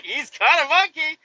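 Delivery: high, loud, laughing insistence; exasperated insistence. Retold or imitated. Driver insisting to his coworker, loud and high. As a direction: insist through disbelief. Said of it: "I'm like".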